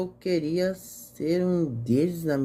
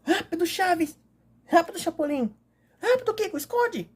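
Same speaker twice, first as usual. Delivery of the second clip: put-on urgent kid voice; 12 semitones higher than usual. Hurrying the gang to hide.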